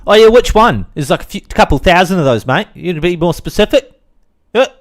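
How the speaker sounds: loud, high, pushy challenge; mock heckler. Heckler asking which podcast, loud high mockery. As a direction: challenge him loudly as a sarcastic viewer. Heckles himself as imagined viewer.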